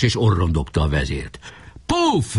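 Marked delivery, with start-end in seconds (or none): 1.9-2.3 high-pitched popping call; echoed battle cry. Acted. Other forts taking up the battle cry, excited. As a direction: throw the word out high. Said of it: "Vették át a jelszót a többi erődök is".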